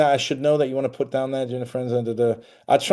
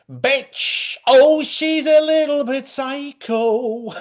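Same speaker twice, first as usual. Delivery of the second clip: high, slow mock voice, much higher, the word bitch squeaked up; hammed-up catty mockery of the other woman; sneering at the woman he left you for, in a high voice; 6 dB louder, much slower than usual. Mocking the ex's new woman.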